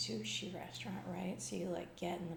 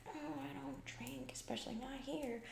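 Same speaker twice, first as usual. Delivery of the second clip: higher, mock-nervous refusal; imitating freaked-out Jeffrey; 7 semitones higher than usual. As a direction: lightly mimic a nervous colleague begging off. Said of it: "he was, like, freaking out".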